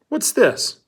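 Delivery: high voiced Pendanski asking; puzzled high counselor voice; Mister Pendanski. A narrator's reading. Pendanski voiced in read story.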